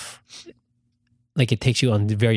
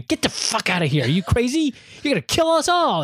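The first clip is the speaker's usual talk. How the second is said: climbing higher with each line, mock fury; rising exasperation at a useless co-pilot; frustrated yell; 13 semitones higher than usual. The rider yells at the pilot crashing the ship, rising higher and higher. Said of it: "I go".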